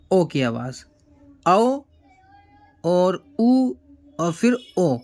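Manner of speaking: او given three ways, o, au and u; three vowel sounds. Shown as a demonstration. Teacher showing pupils three sounds of او. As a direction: contrast each او carefully. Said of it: "تین طرح کی آوازیں ہیں".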